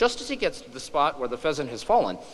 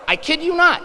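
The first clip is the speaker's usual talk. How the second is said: much higher, louder insistence; emphatic; 9 dB louder than usual. Real, not acted. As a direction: speaker swears the sign is real, loud and high. Emphatic aside to audience.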